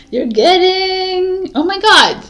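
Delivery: squeaky, high, loud breaking into laughter; can't get the word out for laughing; laughing fit. Real, not acted. Reader loses it while filming a blooper, laughing loud and high. Cracking up mid-reading, blooper.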